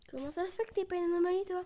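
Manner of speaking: fast, high puzzled question; mock puzzled. Doctor puzzled by a normal patient, fast high question. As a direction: quiz the patient in mock disbelief.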